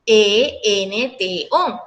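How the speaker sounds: letters E N T O said one by one, very slow; spelled out slowly. Shown as a demonstration. Teacher spells the rhyme ending for students, very slow. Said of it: "termina en".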